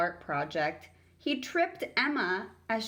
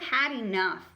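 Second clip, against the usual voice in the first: ruffled; 5 semitones higher, 3 dB louder than usual. Acted.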